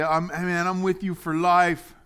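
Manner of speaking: loud, high, hammed-up promise; mock loyal pledge. Friend pledging loyalty for life, overblown and loud. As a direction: swear it big, like a devoted buddy.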